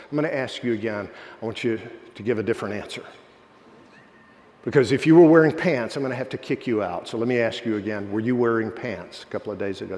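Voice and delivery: low, controlled official's warning; stern warning. Retold or imitated. Administrator warns student she may be kicked out, stern and controlled. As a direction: press for another answer, voice kept low. Administrator repeats question with threat.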